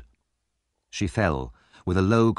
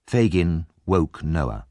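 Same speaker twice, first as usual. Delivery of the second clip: plain reading voice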